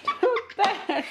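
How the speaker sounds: slow, high, laughing through words. Real, not acted. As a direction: laugh while scolding. Same words, now laughing.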